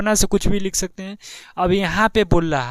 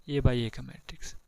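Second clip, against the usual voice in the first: soft, drawn-out repeat; 4 semitones lower, 11 dB quieter than usual. Shown as a demonstration. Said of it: "A by ए का matrix क्या बोल रहा है".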